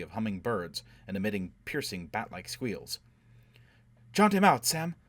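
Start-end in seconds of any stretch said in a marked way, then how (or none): 4.1-5.0 high, loud urgent command; urgent order. A narrator's reading. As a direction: order him out, voice raised. Urgent order during escape.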